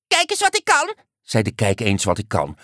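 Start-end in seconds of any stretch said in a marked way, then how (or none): 0.1-0.9 very high, squeaky little voice; show-off little creature. A narrator's reading. Little creature showing off a trick, squeaky-voiced. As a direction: pipe up in a tiny high creature voice.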